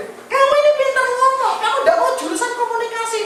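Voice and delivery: coaxing father. Retold or imitated. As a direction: talk the kid into it with a laugh.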